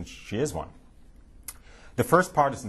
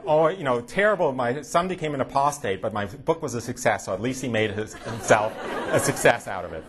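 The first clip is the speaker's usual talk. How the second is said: higher, louder hammed-up lament, laughing; mock parental lament; 4 semitones higher, 3 dB louder than usual. His parents lamenting yet proud of his book, higher and louder. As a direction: ham up the parents' kvetch.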